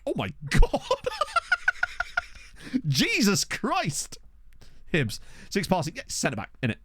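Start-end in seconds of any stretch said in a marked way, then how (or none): 0.1-0.8 loud, higher exclamation cracking into a laugh; real astonished laugh; laughing disbelief. Real, not acted. Stunned reaction breaking into laughter. 2.9-4.2 high, slow, drawn-out disbelief; amused shock, pitched up; astonished exclamation. Real, not acted. Stunned, amused by what he reads.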